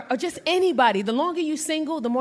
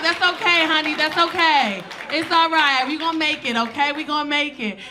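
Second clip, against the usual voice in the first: loud, high, effusive comforting character; rally everyone in for a big consoling hug; hammed-up flight attendant calling out reassurance; 6 semitones higher, 6 dB louder than usual.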